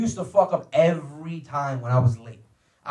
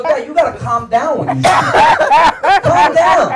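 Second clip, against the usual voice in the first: high, loud plea to dad; exasperated shout; 9 semitones higher, 10 dB louder, slower than usual. Acted. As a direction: yell at your father to settle. Son shouting at angry father.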